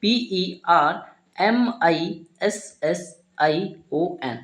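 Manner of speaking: slow spelling, each letter set apart; deliberate classroom spelling with gaps between letters. Shown as a demonstration. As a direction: spell it out one letter at a time. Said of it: "एक एक letter का उच्चारण".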